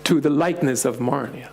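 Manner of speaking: ordinary talk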